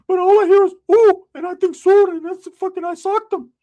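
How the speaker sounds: put-on high, loud whimpering teen voice; crying cousin voice. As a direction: wail it like a scared kid cousin. Cousin Ricky explaining the punch.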